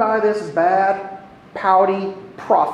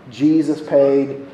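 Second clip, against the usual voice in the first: ordinary talk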